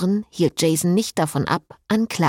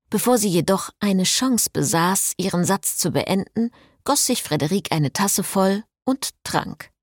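ordinary delivery

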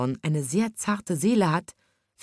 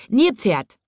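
raised, higher irritated call; calling out crossly; 5 semitones higher, 5 dB louder than usual. A narrator's reading. Penguin scolding the hippo, calling out crossly. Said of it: "rief er".

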